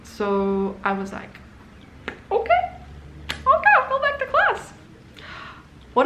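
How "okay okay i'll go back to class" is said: much higher, sing-song fake obedience; mock meek compliance. Schoolgirl to principal, mock sweet okay. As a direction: play the good girl sweetly, tongue in cheek.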